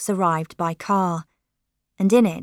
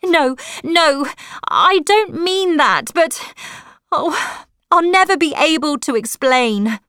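plead, voice pitched up in distress; crying out; 7 semitones higher than usual. A narrator's reading.

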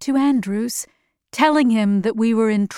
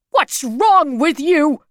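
slow, raised, indignant question; angry scolding; 4 semitones higher, 3 dB louder, slower than usual. A narrator's reading. Bald man berating the distraught woman, angry scolding. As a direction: berate her, pitch pushed up.

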